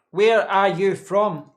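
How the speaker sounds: slow right down like the viewer asked; deliberately slow. Shown as a demonstration. Streamer answering a chat request to talk slowly, exaggeratedly slow. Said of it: "talk slowly".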